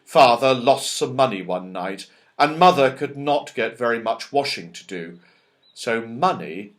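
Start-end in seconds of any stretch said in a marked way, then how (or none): none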